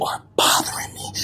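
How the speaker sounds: breathy whisper, no voice. Acted. Whispering to the critic that they're bothering him. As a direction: hiss it softly to the critic.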